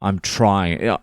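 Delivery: ordinary talk, nothing marked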